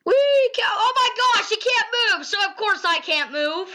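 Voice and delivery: high-pitched frantic whine; exasperated complaint. Real, not acted. Player stuck on a line, high exasperated complaint.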